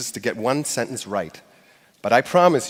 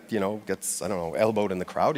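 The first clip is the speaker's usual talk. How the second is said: ordinary delivery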